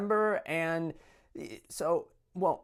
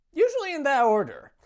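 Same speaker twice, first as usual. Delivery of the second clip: quick, high, louder comic aside; a sudden sarcastic quip tacked on; wry punchline; 11 semitones higher, 6 dB louder, faster than usual. Acted. Joke punchline after listing vices.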